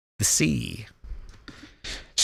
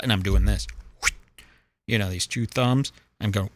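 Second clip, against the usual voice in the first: ordinary talk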